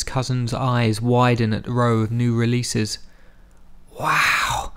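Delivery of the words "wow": breathless. A narrator's reading. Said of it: "Zalmay said breathlessly".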